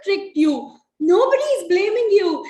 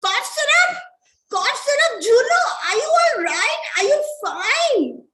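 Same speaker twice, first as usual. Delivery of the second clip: alarmed high; 8 semitones higher than usual. Acted.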